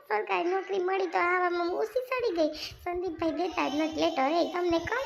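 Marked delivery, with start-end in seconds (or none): none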